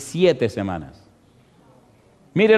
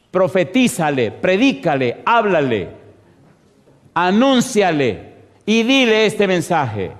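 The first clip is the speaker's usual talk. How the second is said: slow, higher, emphatic commands; preaching proclamation; 4 semitones higher than usual. Acted. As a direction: proclaim each command to the congregation.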